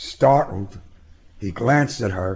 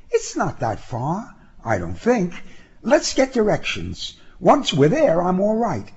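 put-on breezy young woman, pitched up; high-voiced young woman; 9 semitones higher than usual. A narrator's reading. Passenger reassuring a reluctant driver, high and breezy. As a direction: brush off the worry in a high female voice.

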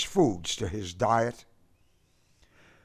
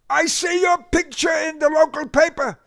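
high, loud booming grocer voice; barrel-chested grocer phoning Boris, booming; 8 dB louder than usual. A narrator's reading. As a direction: boom it out with heavy accent. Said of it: "he boomed".

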